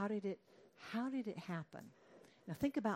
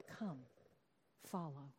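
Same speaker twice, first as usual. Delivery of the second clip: hushed invitation; 4 semitones lower, 7 dB quieter, slower than usual. Acted.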